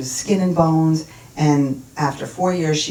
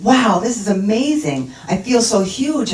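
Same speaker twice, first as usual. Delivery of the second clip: higher-pitched, awestruck wonder; retold amazement; 5 semitones higher, 4 dB louder than usual. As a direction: marvel as if discovering something immense. Retold amazement at feeling boundless love.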